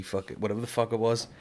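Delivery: normal talking voice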